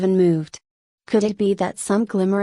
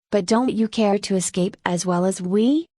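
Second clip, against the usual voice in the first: ordinary delivery, nothing marked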